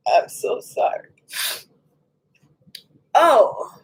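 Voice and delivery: whispered apology breaking into a sob; tearful whisper, then a crying "oh". Real, not acted. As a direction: whisper it through real tears. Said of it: "I am not the one to cry like that".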